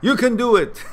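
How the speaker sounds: loud, high rallying cry; encouraging shout. Real, not acted. Cheering on a heartbroken viewer, loud rallying shout. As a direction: pump him up, shout it out.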